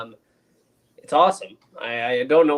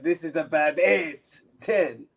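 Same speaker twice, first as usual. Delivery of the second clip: put-on French accent; 3 dB quieter than usual. Host voicing a chatter's rating, mock French accent. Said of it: "Don't ask why he's French".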